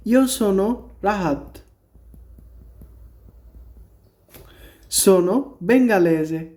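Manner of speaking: teacher demonstrates introducing yourself; example introduction. Shown as a demonstration. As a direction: model self-introduction in Italian. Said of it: "যেমন".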